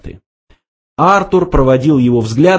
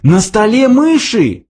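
high-pitched startled yelp; sudden yell; 12 semitones higher, 3 dB louder than usual. A narrator's reading. Arthur suddenly spots mice on the table, startled high yell. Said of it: "внезапно завопил".

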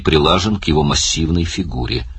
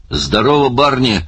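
hearty higher-pitched roar, mildly done; barked greeting; 7 semitones higher than usual. A narrator's reading. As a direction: bark out a hello to a friend. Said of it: "рявкнул Оттер". (рявкающее приветствие, слегка повышенный голос)

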